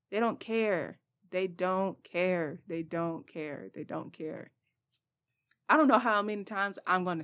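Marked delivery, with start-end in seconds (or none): 0.1-4.5 softer and slower each time, down to near nothing; fading repetition. Real, not acted. Vlogger to viewers, fading refrain. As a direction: let the refrain die away.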